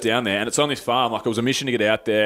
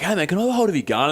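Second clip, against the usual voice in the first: eager request; 6 semitones higher, faster than usual. Retold or imitated. Friend's eager request retold.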